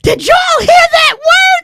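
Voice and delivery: high, loud retold shriek, slow; retold scream. Host retelling shouting down the church row, high. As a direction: squeal it down the pew in glee. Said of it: "I screamed down the row".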